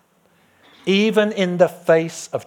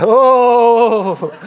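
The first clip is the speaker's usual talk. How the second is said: long, high, loud "ooooh"; exaggerated drawn-out "oh"; 10 semitones higher, 11 dB louder than usual. Reacting to Andy Taylor's name, exaggerated high oh. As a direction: hold a big theatrical oh. Mock reaction to a named person.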